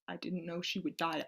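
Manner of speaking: hushed, voiceless confession; guilty whisper. Acted. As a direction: whisper it as if afraid to admit it.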